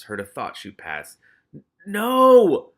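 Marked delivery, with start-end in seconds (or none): 1.9-2.7 high, loud no; shrieking no; anguished scream. Acted. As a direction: cry it out as it dies. Rat King's dying cry.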